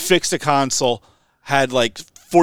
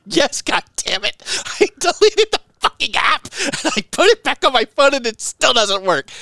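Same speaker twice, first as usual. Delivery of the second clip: mock angry; 11 semitones higher than usual. Caller retelling his reply to tech support, mock angry. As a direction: snap it back like the exasperated caller. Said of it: "I was so mad".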